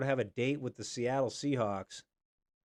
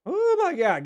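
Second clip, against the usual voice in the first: high shocked oh my God; shocked cry; 10 dB louder than usual. Real, not acted. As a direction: gasp it out in shock. Reacting to fumble scare.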